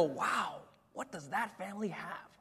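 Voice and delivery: soft, wondering voice; hushed awe. Retold or imitated. The world watching the church family, hushed awe. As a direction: imitate an onlooker marvelling quietly.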